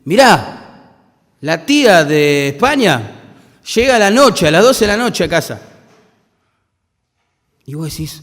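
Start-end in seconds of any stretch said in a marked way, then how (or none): 0.0-5.6 urgent phone news. Retold or imitated. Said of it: "te llaman ... y te dicen".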